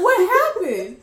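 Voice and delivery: loud, high, laughing disbelief; mock bewilderment. Grandchild finding grandma suddenly asleep, loudly baffled. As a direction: exclaim it, comically baffled.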